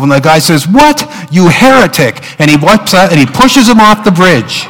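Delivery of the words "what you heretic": high-pitched mock indignation, leaping on the first word; acted outrage. Man denouncing a fellow Baptist on a bridge, acted outrage. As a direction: play sudden comic horror and accusation. Punch line, man turns on the other.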